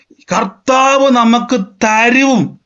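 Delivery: hypothetical husband reassuring. Pretend. Husband voiced reassuring wife.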